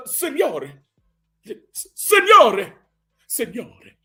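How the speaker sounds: high, drawn-out Italian "signore" climbing and then fading; Italian cop calling out "signore". Retold or imitated.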